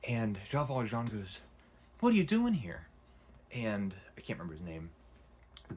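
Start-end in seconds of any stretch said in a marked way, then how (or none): 2.0-2.9 high, loud startled question; startled demand. Retold or imitated. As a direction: blurt it out alarmed, much higher. Valjean confronts the gardener.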